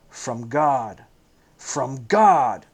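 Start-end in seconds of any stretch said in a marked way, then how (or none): 1.6-2.7 cries out loud and troubled; a startled, troubled outcry; echoing the words back in alarm; troubled cry. A narrator's reading. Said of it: "the rabbi cried out, troubled".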